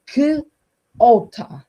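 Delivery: letter K sounded alone, then a loud "ota"; głoskowanie. Shown as a demonstration. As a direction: split the word as "k", pause, "ota". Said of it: "potem się ciężko uczy dziecka, dziecko czytać". („k” osobno, pauza, głośne „ota”)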